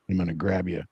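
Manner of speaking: low, growly put-on voice, menacing; rough tough-guy threat, low; deep feminine voice. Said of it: "that's a deep feminine voice you got there".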